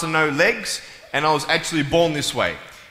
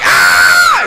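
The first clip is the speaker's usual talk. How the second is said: loud mock scream; hammed-up shriek of fright; imitated girl's scream; 12 semitones lower, 11 dB louder than usual. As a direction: scream like a terrified kid. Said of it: "She's like".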